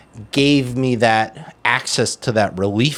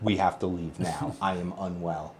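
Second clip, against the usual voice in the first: tense hushed plea; 5 semitones lower, 13 dB quieter than usual. Retold or imitated. Urgently telling wife they must leave.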